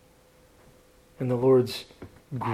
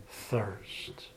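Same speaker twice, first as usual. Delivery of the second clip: whispered thirst, lower and much softer, closing the promise; whispered word; 6 semitones lower, 14 dB quieter than usual. Acted. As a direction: breathe the word thirst out as a whisper, soft and low. Dramatic hush on the final word.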